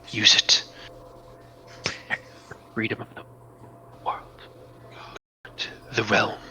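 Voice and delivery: soft, breathy incantation whisper; the mystic Meningian bestowing power, hushed. Acted. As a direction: whisper the gift into his heart.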